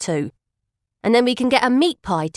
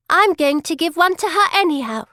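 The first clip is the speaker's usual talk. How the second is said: put-on firm little girl; 7 semitones higher than usual. A narrator's reading. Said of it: "says the sainted girl firmly".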